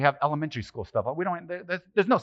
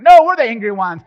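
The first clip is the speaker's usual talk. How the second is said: louder mock claim to be the angriest; mock Irish boast; 7 semitones higher, 12 dB louder than usual. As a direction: boast it, half joking. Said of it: "the Irish people is like".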